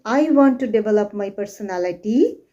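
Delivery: quick, run-together sentence without emphasis on "develop"; no stress. Shown as a demonstration. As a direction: rattle it off, stressing nothing. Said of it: "So instead of saying".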